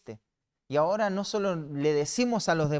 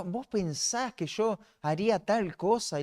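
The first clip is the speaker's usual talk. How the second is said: mock tearful liar; 5 semitones higher than usual. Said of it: "por eso va a llorar".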